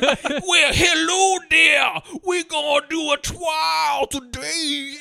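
loud, high, hugely drawn-out New Orleans lawyer; over-the-top New Orleans accent. Retold or imitated. Co-host imitating the lawyer's introduction, absurd New Orleans accent. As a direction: stretch every word in a crazy Southern lawyer holler. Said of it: "his accent is so fucking nuts and over the top".

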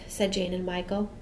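ordinary delivery, nothing marked

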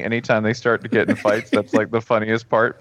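normal talking voice

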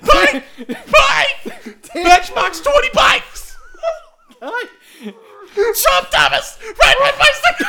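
very high, drawn-out spliced shrieks; hammed-up screaming. Doctored tape that won't stop, shrieking absurdly. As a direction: screech each word like a tape gone berserk.

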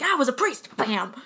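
loud, high, hammering outburst; aggressive mock shout. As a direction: shout it as if slamming down each blow. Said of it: "like, very aggressively".

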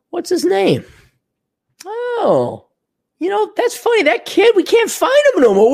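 high, sing-song fake innocence; mock innocent. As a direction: play dumb in a high falsetto. Mock innocent about missing kid.

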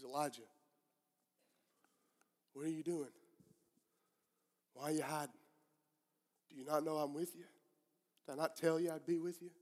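soft, low questioning with long pauses; quiet divine voice. Acted. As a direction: play God speaking gently into the silence. God speaks to Elijah in silence.

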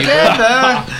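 high, slow, laughing mock chant of Together; drawn-out high giggle on Together; mock hippie chant, laughing.

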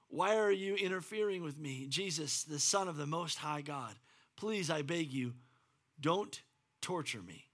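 mild begging. Acted. Possessed man begging Jesus.

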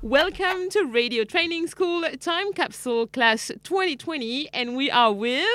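bright, lifted announcer read to open the show; radio host intro. Acted. Radio host welcoming listeners to the time capsule class, announcer read.

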